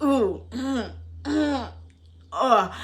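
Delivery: high, squirming ooh and ugh; irked groan. Retold or imitated. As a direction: squirm in disgust at the page. Irked reader's reaction.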